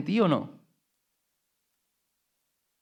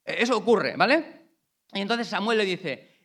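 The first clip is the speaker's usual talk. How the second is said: raised, driving sermon voice; fervent preacher building the story; preaching at full force; 6 semitones higher, 6 dB louder than usual. Acted. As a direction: preach it out with fire. Passionate retelling of Saul.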